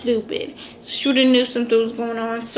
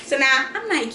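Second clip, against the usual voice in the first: ordinary talk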